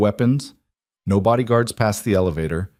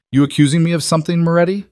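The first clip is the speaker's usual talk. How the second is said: higher, louder and fast, a sudden jab; sharp rising challenge; 8 semitones higher, 5 dB louder, faster than usual. Acted. Rival boss snaps at Damien at the meeting, higher, louder and fast. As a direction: push back at him hard and quick.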